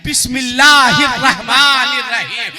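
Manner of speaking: bismillah called out loud; ringing bismillah. Acted. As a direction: proclaim it with full force. Climax, girl recites before reaching in. (ज़ोर से गूंजती बिस्मिल्लाह)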